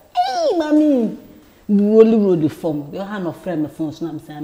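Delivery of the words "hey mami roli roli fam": high, slow, drawn-out louder call; mock cry to mother. Imitating the call to her mother, high and drawn out. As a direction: stretch the cry out like a wailing child.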